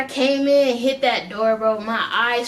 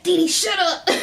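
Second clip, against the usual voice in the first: friend cutting in; 7 semitones higher than usual. Retold or imitated. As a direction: snap at your high friend. Voicing friend Vince.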